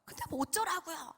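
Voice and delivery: exasperated, swooping acted outburst; acted irritated defiance; defiant back talk. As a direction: talk back like a fed-up child. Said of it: "감히 겁도 없이 막 하나님께 대들었어요".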